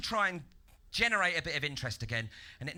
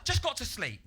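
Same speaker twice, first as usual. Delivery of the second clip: ordinary talk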